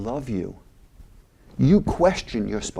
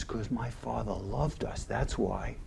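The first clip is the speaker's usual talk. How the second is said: much softer, near-whispered conviction; hushed Archie; 11 dB quieter than usual. Retold or imitated. Archie telling Michael his father loved him, much softer. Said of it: "He says".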